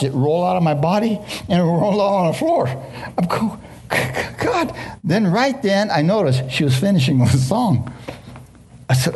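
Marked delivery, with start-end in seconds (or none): 4.4-4.7 sudden very high cry of God; stunned cry. Retold or imitated. Preacher realizing his mistake, crying out God very high. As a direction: let it jump up in shock.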